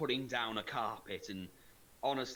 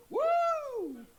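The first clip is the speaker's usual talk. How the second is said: excited whoop; 8 dB louder than usual. Retold or imitated. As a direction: let out a big yee-haw whoop.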